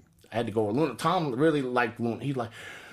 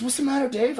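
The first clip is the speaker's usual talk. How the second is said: higher, mildly concerned buddy; voicing Knobs; 11 semitones higher than usual. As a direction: ask it up high like a worried friend. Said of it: "and Knobs goes".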